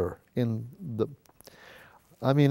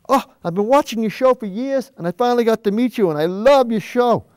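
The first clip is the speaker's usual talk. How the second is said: higher, quicker gushing fan voice; imitating an excited fan; 6 semitones higher, 4 dB louder than usual. Fan at Michael's gushing to the TV painter, excited. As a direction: gush like a starstruck fan meeting his hero. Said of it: "this guy come up to me all excited".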